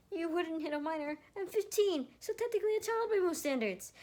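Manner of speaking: put-on high, frantic boy's excuse; panicked plea. Panicked boy pleads being a minor.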